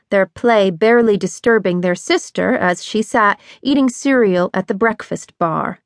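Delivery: ordinary delivery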